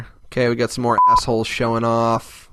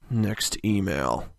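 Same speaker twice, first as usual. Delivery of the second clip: low slow drone; 6 semitones lower, 7 dB quieter, much slower than usual. Acted. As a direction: lower and much slower, deadpan. Bored after a mushy email.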